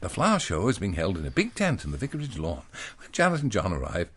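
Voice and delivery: lightly hammed-up children's reader voice; reading to children. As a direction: read it like an old school primer. Reads a children's primer aloud.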